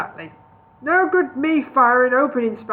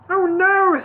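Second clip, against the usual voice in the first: put-on high, louder old wizard voice; a fussy wizard brushing it off; Gandalf voice; 9 semitones higher, 6 dB louder than usual. A narrator's reading. Gandalf brushing off Bingo's question, high and fussy.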